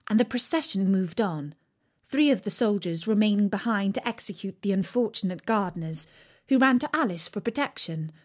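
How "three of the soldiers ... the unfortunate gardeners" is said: ordinary delivery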